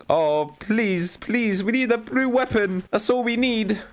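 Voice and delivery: high-pitched, whiny begging; pleading. Real, not acted. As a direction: plead with rising desperation. Begging the game for loot.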